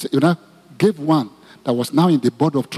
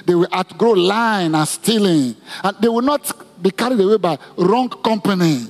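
leading prayer; 3 semitones higher than usual. Acted. Pastor leads congregation praying for mothers, fervent prayer. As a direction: lead the church in prayer for mothers and children.

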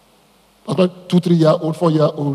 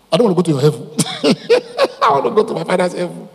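laughing; 2 semitones higher, faster than usual. Real, not acted. Preacher turning down another's heaven, laughing. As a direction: break up laughing between the sentences.

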